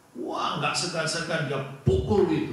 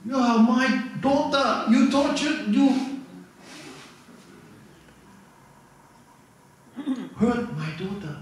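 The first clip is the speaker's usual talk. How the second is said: angry father; 8 semitones higher than usual. Acted. Soldier father confronting abusive boyfriend.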